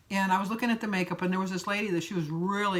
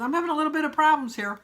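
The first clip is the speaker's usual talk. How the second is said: quick, higher, slightly flustered; fretting hairdresser, quick and worried; 6 semitones higher, 4 dB louder, faster than usual. Retold or imitated. Hairdresser fretting over my haircut, mildly flustered and quick. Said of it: "She was so upset".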